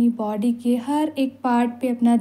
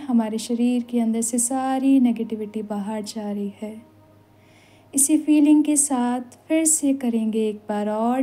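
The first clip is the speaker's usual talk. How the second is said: ordinary delivery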